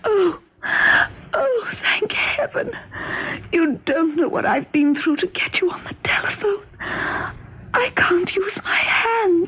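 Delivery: breathless tearful whispering. Acted. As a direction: whisper through tears, exhausted. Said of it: "Why are you whispering?".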